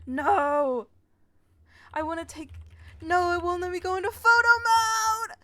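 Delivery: high, loud cry of dismay; real outrage, pitched high and loud; frustrated outburst. Real, not acted. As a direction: yell at the screen in disbelief. Photo mode blocked, outraged.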